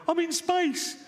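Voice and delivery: high, awed gushing space tourist. Retold or imitated. Floating space tourist gushing in awe, voice pitched high. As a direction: gasp out the wonder of floating in space. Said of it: "you float around going".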